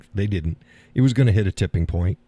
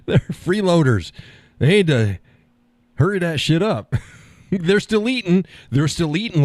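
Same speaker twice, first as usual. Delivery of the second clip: mock indignant rant; 9 semitones higher than usual. Real, not acted. Mock outrage at hens.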